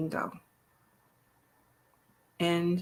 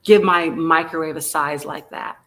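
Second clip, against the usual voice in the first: mock indignation; 7 dB louder than usual. Mock-offended over microwave.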